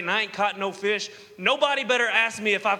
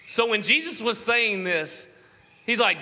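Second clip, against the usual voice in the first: normal talking voice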